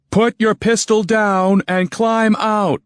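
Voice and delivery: high, slow order at gunpoint; firm command. Acted. Hero orders the tank gunner out, firm and slow. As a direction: bark it out slowly, gun to his skull. Narrator voices the hero's orders.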